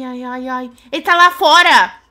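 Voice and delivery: normal talking voice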